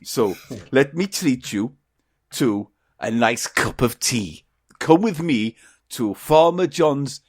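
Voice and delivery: put-on Welsh whale voice; Welsh accent. Whale inviting the duck to tea, Welsh accent. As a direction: invite them with a Welsh lilt.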